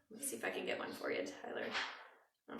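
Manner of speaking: unvoiced, fast whisper; hushed, hurried aside. Real, not acted. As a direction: murmur it to herself off-mic. Aside while working.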